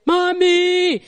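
put-on high, loud, drawn-out child's cry; screaming child. Child calling out to parent in amazement, high mock scream. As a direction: imitate a child shrieking for a parent. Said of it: "She even screams".

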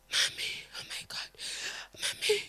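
frightened whispered cry; shocked whisper. Retold or imitated. Sister whispering in shock as her brother is beaten. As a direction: breathe it out in alarm. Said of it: "then the sister was there".

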